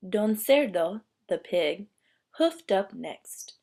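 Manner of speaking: plain reading voice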